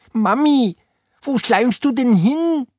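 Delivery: high, squeaky creature voice; clingy slime creature. A narrator's reading. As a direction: squeak after a fleeing mummy. Slime creature calls after fleeing Oda. (hoch quäkende Schleimstimme)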